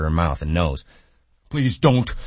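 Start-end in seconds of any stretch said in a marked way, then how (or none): 1.5-2.1 high, loud panicked plea; begging. A narrator's reading. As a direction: plead in a childlike panic. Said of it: "he begged".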